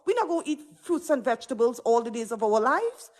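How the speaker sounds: usual speaking voice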